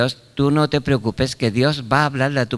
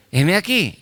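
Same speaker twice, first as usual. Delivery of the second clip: put-on eager young Samuel; 7 semitones higher than usual. Samuel answering.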